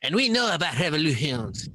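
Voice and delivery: mock French accent, high; hammed-up French chef voice. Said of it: "We're working on the Macron. Voice".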